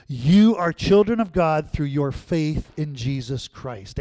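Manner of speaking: ordinary talk, nothing marked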